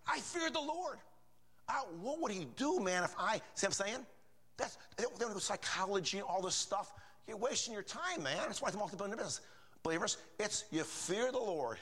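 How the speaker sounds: high-pitched, fervent preacher; impassioned preaching. Real, not acted. Preaches with rising fervour.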